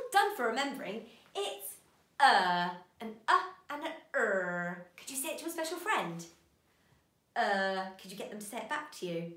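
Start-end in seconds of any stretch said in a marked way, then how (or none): none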